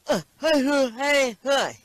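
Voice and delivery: high, loud garbled hey hey; eager garbled hollering; tongueless mumble. Acted. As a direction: blurt it out like a man with no tongue. Man with no tongue answers king.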